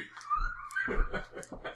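very high squeal of laughter. Real, not acted. High laugh after joke.

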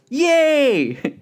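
high, loud, drawn-out cheer; acted-out whoop of joy; excited daughter. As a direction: burst out in mock girlish delight. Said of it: "She got all excited".

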